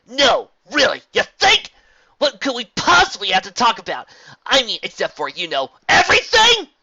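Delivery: high, loud bitter sarcasm; sarcastic anger. A narrator's reading. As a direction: throw her questions back at her. Angry sarcastic outburst at mentor.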